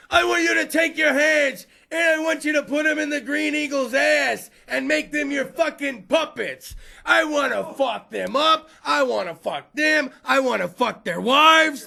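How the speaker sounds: loud, high shouted pep talk, slowing at the end; raging coach. Retold or imitated. Hockey coach yelling a pep talk to young kids. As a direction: scream it at the kids like a coach gone too far.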